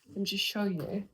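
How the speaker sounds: ordinary talk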